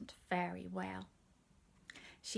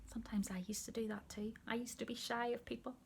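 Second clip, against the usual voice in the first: hushed aside; 3 semitones higher, 7 dB quieter, faster than usual. A narrator's reading.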